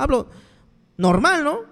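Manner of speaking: ordinary talk, nothing marked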